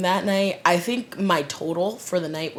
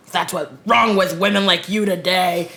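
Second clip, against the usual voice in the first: louder retold rant; mock scolding; 3 semitones higher, 8 dB louder than usual. His friend goes off on her, mock scolding. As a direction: imitate a man going off on her.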